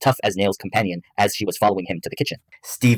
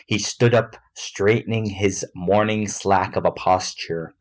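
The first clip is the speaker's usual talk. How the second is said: ordinary delivery, nothing marked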